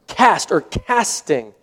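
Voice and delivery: deliberate, weighty stress on cast and casting; emphasis on cast. Real, not acted. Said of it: "I'm emphasizing that word".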